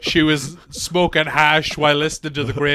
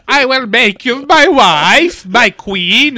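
higher, louder, slow mock declaration; grand proposal; 5 semitones higher, 7 dB louder, slower than usual. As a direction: declare it like a hammed-up suitor. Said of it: "It's like".

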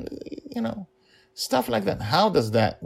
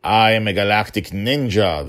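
a hint of a boastful villain; lightly voiced proud emperor; cold rasping evil emperor; 4 semitones higher, 7 dB louder than usual. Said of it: "the Emperor said".

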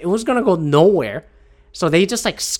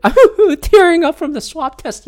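talking through a real laugh, higher and louder; 11 semitones higher, 5 dB louder than usual. Real, not acted. Host laughs at himself after gulping while signing off, laughing. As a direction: chuckle it out after a gulp. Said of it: "Sorry, I gulped a little bit".

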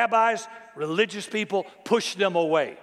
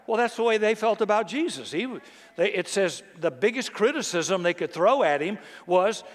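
normal talking voice

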